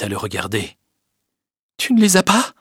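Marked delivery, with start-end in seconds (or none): 1.8-2.5 high, rising incredulous question; shocked, high-pitched query; stunned disbelief. A narrator's reading. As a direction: ask as if unable to believe it. Stunned at being refused.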